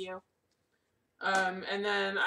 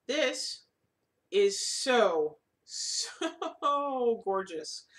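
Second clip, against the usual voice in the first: laughing; 3 semitones higher, 4 dB louder, much slower than usual. Real, not acted. Admiring fabric, laughing.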